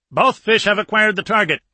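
high, loud callout; excited report. Acted. Crewman reports torpedoes found target, excited callout. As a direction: report it urgently to the captain.

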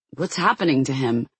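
usual speaking voice

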